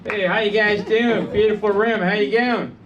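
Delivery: chirpy Australian greeting, faked; put-on Australian accent. Comic opening his set to the room, put-on Australian accent. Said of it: "I've been working on this stupid accent".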